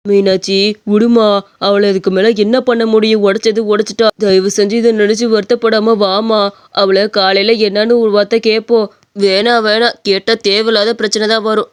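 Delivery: low-pitched consoling husband. Acted. (தாழ்ந்த குரலில் சமாதானப்படுத்தும் கணவன்)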